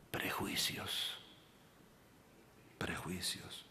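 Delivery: drawn-out, breathy "Prejuicios"; slow whisper. Real, not acted. Pastor driving home the lesson to the congregation, whispered. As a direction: whisper the word like a warning.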